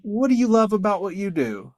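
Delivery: lightly voiced kind judge; gentle curious question. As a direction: ask warmly as the justice did. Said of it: "she's like".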